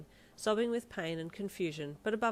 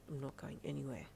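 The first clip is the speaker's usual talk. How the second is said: lightly voiced gruff partner, low and soft; a low, quiet grunt of reassurance; 4 semitones lower, 8 dB quieter than usual. Partner refusing to leave the patient, grunting. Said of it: "he grunted".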